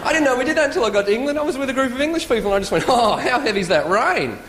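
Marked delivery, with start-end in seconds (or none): 2.9-4.4 slow, drawn-out exclamation falling on "rain"; stretched Aussie question dropping on "rain"; rhetorical question. Retold or imitated. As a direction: marvel at it, needing no answer. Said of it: "We ask questions that don't need answering".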